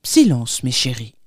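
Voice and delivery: sternly hushing, voice dropping low. A narrator's reading. Pastor silencing his children, stern. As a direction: cut them off, falling to a grave low. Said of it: "dit sévèrement le pasteur".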